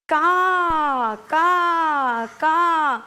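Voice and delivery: big drawn-out demonstration caws; crow caw. Shown as a demonstration. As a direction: caw out firmly so the children copy. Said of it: "എല്ലാവരും ഉച്ചത്തിലൊന്ന് പറഞ്ഞേ".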